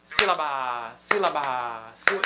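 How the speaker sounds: "sílaba" with quick start and dragged-out last syllable, low; ultima sillaba allungata. Shown as a demonstration. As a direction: rush "síla", then hold the final "ba" too long. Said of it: "A última tá durando mais".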